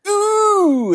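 high, loud hammy villain; hammy movie villain. Retold or imitated. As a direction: ham up a campy villain.

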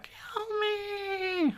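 high, soft, crying plea; terrified horror victim. Retold or imitated. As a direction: sob it out in a tiny high voice.

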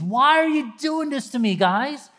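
high, hurt, bewildered pleading; confused pleading. Acted. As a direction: plead like a betrayed kid. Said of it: "he's so confused".

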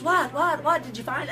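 high, urgent repeated question; retold impatient curiosity; eager questioning. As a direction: imitate a kid bursting to know. Child eagerly asking for the news.

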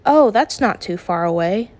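usual speaking voice

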